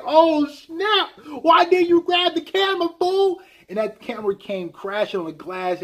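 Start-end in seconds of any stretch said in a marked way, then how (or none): 0.0-3.4 high, loud exasperated reaction; excited mock shout. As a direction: yell at the screen like a fan. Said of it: "And I'm like".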